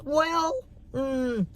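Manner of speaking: high, soft, reluctant hedge; hedging. Retold or imitated. Retelling a reply to the long-winded date, hedging. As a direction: squeak out a polite non-answer. Retold reluctant answer to his question.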